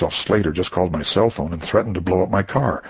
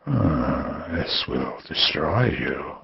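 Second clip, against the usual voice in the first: slow, softer whisper of a threat; menacing whisper; 3 semitones lower, 6 dB quieter, much slower than usual. Acted. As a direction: breathe it out slowly like a promise. Villain savours threat.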